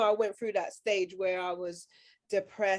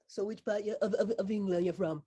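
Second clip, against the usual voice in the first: sceptical questioner; 5 dB quieter than usual. Retold or imitated. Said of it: "they're like".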